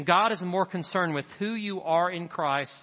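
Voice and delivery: ordinary delivery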